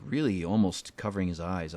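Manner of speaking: ordinary talk, nothing marked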